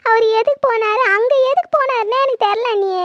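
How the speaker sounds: ordinary delivery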